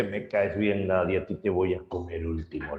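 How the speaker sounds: low, soft, tipsy giant; a hint of a drowsy drunk cyclops; drunk and happy. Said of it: "terminó ebrio y contento". (un toque de cíclope ebrio y contento, en voz baja)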